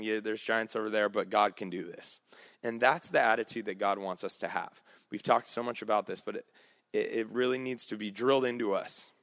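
ordinary talk

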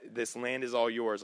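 usual speaking voice

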